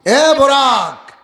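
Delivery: loud, high, drawn-out hail; calling out. Retold or imitated. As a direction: call slowly to someone far away. Jibrail calls out to Borak. (টেনে টেনে জোরে ডাকা)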